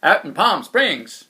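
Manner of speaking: loud warning shout; yelling. Acted. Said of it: "he yelled".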